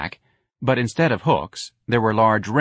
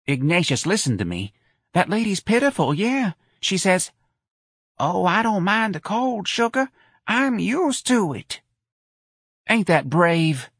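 high, sentimental mother, very high at the quote; a mother moved by a brave old woman; Missus Riley pleading; 11 semitones higher than usual. A narrator's reading. Mother pleads with her son about the old lady, high sentimental voice.

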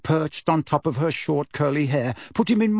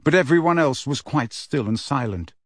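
ordinary delivery

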